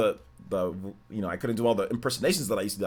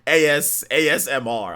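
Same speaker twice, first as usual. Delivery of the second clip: elevated voice; 5 semitones higher, 8 dB louder than usual. Shown as a demonstration. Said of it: "my voice is boring and monotone".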